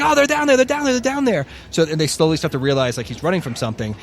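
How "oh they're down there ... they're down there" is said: high imitated panicked warning; terrified warning. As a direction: blurt it in terror. Terrified soldier warns.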